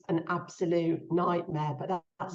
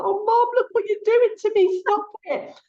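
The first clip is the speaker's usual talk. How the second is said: high, louder, tearful half-laughing plea; 14 semitones higher, 7 dB louder than usual. Retold or imitated. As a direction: plead through tears, voice lifted high. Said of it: "And I was just crying".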